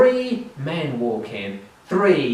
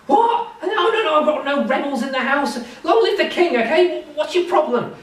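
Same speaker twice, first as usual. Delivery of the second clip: much higher, nervous laughing denial; panicked mayor; 12 semitones higher than usual. Acted. Frightened mayor insists to the captain he hides no rebels.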